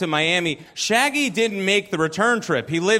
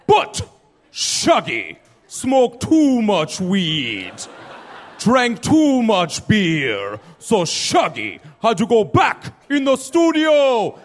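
loud, very slow bad Jamaican accent; 3 semitones higher, 3 dB louder, much slower than usual. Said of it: "I gotta do a Jamaican accent".

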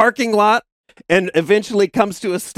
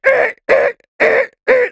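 very high, louder imitated screech; mock scraping noise; 4 dB louder than usual. Retelling Josh scraping the car along the side, imitated screech. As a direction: squeal like metal scraping the road. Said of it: "And he's, he's like".

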